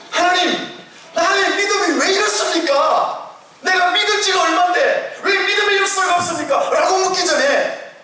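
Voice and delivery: rising pulpit fervour; preaching at full force. Acted. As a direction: preach it up high and strong. Acted prayers within sermon.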